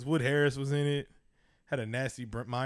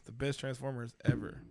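ordinary delivery

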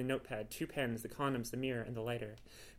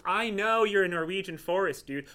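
slow, loud and exasperated; 6 semitones higher, 9 dB louder than usual. Acted. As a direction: spell it out for him, fed up. Adrian exasperated with cat.

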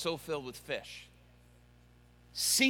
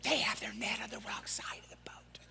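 softer, conspiratorial whisper; whispering fish; 11 dB quieter than usual. Acted. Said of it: "there are fish under there going".

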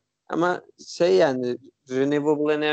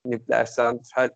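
ordinary talk

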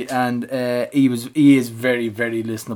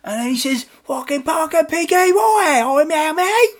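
Mark Bertram impression. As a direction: ham up a cockney bloke. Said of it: "this Mark Bertram impression".

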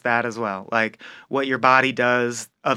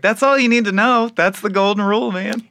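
excited exclamation; 8 semitones higher than usual. Retold or imitated. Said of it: "And I'm like".

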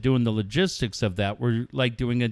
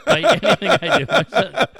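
laughing; 6 semitones higher, 6 dB louder than usual. Real, not acted. Laughing about his son.

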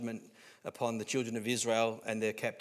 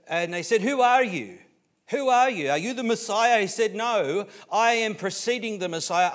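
high, loud sermon dialogue; full-force preaching; 8 semitones higher, 9 dB louder than usual. Acted. Preacher retelling John's questioning to the congregation, loud and forceful. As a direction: fire out question and answer like a preacher.

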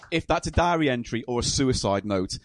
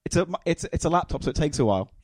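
ordinary delivery

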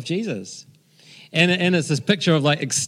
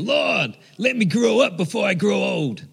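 lightly American prayer, lifted high; a hint of an American accent in prayer; 5 semitones higher than usual. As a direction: pray it like John Wimber, American style. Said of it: "in an American accent".

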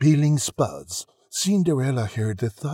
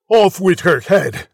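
loud, raised royal order; roared command; 6 semitones higher, 9 dB louder than usual. A narrator's reading. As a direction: roar it as an order. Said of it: "the prince roared back".

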